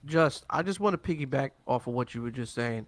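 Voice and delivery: ordinary delivery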